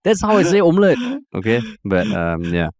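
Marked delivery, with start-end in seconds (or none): 0.0-1.0 exasperated. Retold or imitated. Exasperated retort to in-laws.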